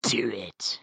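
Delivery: whispered villainous urging; sinister whisper, softly goading; Palpatine. Acted. Villain urging Anakin to kill, sinister whisper. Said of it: "he's literally like".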